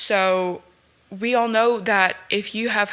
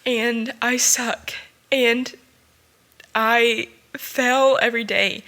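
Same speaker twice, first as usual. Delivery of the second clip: emotional; 3 semitones higher than usual. Real, not acted. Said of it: "I don't wanna get emotional".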